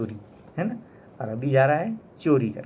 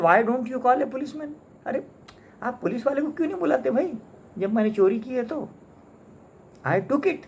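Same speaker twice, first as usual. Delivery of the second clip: high, taunting dare to call the cop; mock him, daring him to report it; sneering mockery; 9 semitones higher than usual. Soapy taunts the umbrella man to call the police, high and sneering. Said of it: "एकदम यानी स्नियर्ड".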